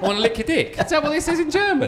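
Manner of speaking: high, loud laughing question; laughing high through the words. Real, not acted. Mishearing the German as a joke.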